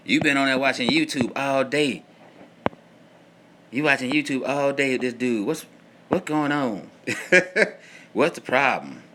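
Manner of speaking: hammed-up wife scolding about YouTube; mock nagging wife. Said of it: "y'all wives will be like".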